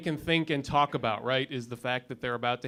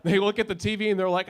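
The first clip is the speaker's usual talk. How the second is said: ordinary delivery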